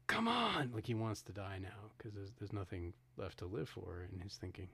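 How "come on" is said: high-pitched, loud retold holler; desperate mock yell. Podcaster retelling a man who wants to die now, imitated desperate yell. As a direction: shout it out as if begging to be attacked. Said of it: "and he yells".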